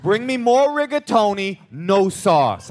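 mock an insistent diner ordering; put-on dad laying down the law; demanding dad. Dad imitated making demands over pasta.

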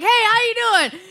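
very high imitated greeting; mock a dad calling out on a video call; put-on, high-pitched cheery hello; oblivious dad.

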